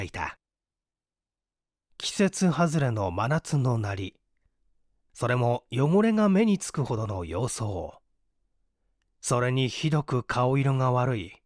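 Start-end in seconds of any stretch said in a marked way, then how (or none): none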